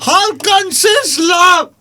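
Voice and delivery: high, very slow shouted put-on voice; loud slow put-on voice. Repeated, louder shouted take.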